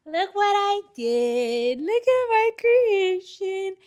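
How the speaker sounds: slow, high, squealing pride; proud. Real, not acted. Vlogger showing viewers her puzzle creation, proudly squealing. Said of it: "I am so excited".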